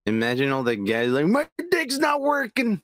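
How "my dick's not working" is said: put-on whiny, slow, higher complaining old guy; mock old man whine. Friend mocking aging men, slow high whine. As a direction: drag it out like a sad old man.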